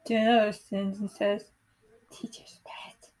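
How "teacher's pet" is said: slow, breathy sneering whisper. Acted. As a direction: hiss it behind her back. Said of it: "A ballet student whispers".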